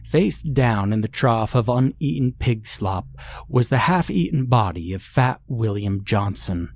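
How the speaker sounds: usual speaking voice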